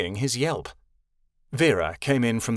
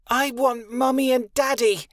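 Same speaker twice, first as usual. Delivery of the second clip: put-on small child whining; 11 semitones higher than usual. A narrator's reading. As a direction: whine like a small child wanting his parents. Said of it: "a small voice whine".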